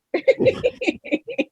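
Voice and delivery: high-pitched giggle; squeaky laugh. Real, not acted. Still laughing at joke.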